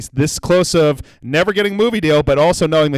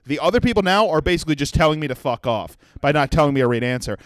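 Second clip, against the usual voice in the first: usual speaking voice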